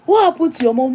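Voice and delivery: high, urgent question to the son; husband asking son. Retold or imitated. Husband asks their son what's wrong, high and urgent. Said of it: "He now called my son".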